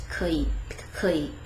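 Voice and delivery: short, soft, casual 可以; clipped-short 可以 with the syllables merged; very natural, quick. Shown as a demonstration. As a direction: toss off 可以 as one quick unit. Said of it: "say really, really naturally".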